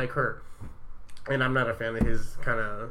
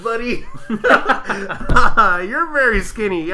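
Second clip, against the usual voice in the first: laughing mock insult; 8 semitones higher, 9 dB louder than usual.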